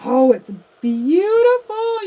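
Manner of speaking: gushing delight. Real, not acted. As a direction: swoon over the color as it goes down. Admiring the new red color.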